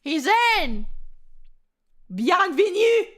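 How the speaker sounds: loud, high announcement; high, ringing cry of welcome; excited welcome. Real, not acted. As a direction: call it out in celebration. Celebrates the applicant getting in.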